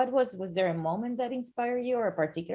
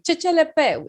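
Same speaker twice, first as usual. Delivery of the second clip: loud, high mock-French cry; imitated crowd catchphrase; 9 semitones higher, 9 dB louder than usual. Everybody echoing the cardiology professor's phrase, high and loud. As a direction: call it out high like a chanting class. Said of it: "everybody was like".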